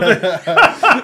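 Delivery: big high burst of laughter; loud laugh. Real, not acted. Laughs at the story.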